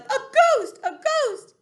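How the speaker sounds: high, loud cry of alarm; panicked yelling. Acted. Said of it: "with everybody yelling".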